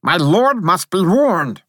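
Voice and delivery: high, loud little soldier; high-pitched, forceful insistence; urgent protest. A narrator's reading. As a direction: insist in a piping voice. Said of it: "I protested".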